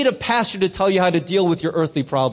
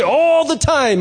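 raised, ringing church call; elder's call, called out loud; 6 semitones higher, 4 dB louder, slower than usual. Retold or imitated. Elder calling out to the congregation, loud and ringing. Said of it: "And then he would say".

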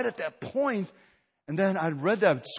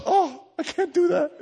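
mock whine; 13 semitones higher, 3 dB louder, slower than usual. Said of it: "Some of us fast lunch".

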